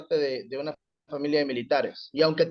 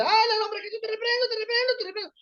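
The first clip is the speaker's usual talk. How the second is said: much higher shrill chant of a panicked exorcist; frantic rebuking; faster than usual. Retold or imitated. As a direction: shriek the rebuke over and over. Chaotic exorcism, others shouting. (reprensión chillona y frenética)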